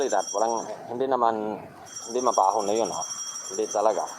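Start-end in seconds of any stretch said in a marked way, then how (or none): none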